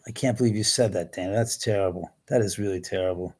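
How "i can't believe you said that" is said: ordinary delivery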